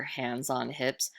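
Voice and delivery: usual speaking voice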